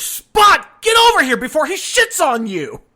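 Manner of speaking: full-force high scream at the dog; mock yell. Mother-in-law warning the family dog at dinner, screaming. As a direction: holler it as an urgent punchline. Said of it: "the mom leans over and goes".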